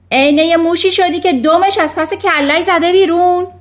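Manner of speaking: loud, high scornful yell; shouting. A narrator's reading. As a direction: yell the insult at full volume. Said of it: "و بعد فریاد کشید".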